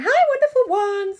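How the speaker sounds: high, loud puppet hello; excited high-pitched character greeting; Fred's greeting. Pretend. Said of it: "'cause he's saying".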